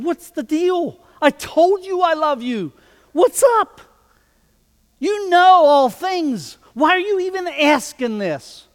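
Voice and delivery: high, loud exasperated outburst, voiced as Peter; upset Peter. Peter, upset, protests to Jesus, high and loud. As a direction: throw up your hands: why ask me again. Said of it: "Peter gets upset".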